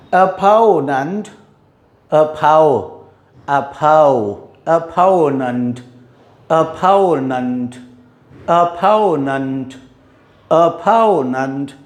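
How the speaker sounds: slow, careful opponent; teacher models opponent slowly for students; slow drill. Shown as a demonstration. धीमा, साफ उच्चारण अभ्यास. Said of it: "slow pronunciation drill".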